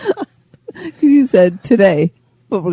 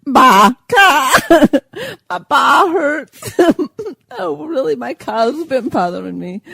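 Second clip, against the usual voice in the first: giggling; 5 semitones higher than usual. Real, not acted. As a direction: giggle while joking. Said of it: "she gets very tickled".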